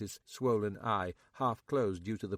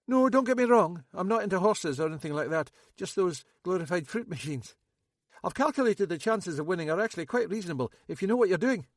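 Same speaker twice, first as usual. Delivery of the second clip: higher, louder nervous local man; Max; 8 semitones higher, 6 dB louder, faster than usual. A narrator's reading. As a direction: play Max high and jittery. Max voiced.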